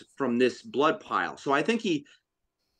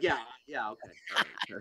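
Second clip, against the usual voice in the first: dismissive; 3 semitones lower, 8 dB quieter, faster than usual. Retold or imitated. Brushing off buddy's complaint.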